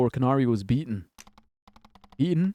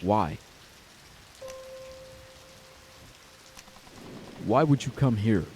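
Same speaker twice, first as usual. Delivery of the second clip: cold and distant; 5 dB quieter than usual. Acted. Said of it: "Her voice was distant, cold".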